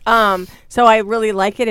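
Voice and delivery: normal talking voice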